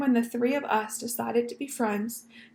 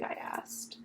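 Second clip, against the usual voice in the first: ordinary delivery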